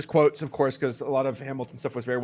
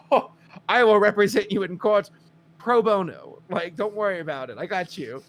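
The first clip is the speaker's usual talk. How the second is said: raised, hammed-up gallant promise; Hamilton; 7 semitones higher, 6 dB louder than usual. Hamilton offering women free legal help, eagerly gallant. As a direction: play the eager rescuer, starting loud.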